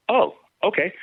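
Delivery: high, surprised "oh okay". Retold or imitated. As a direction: caught off guard, pitch jumps up. Stunned reply to coach's offer.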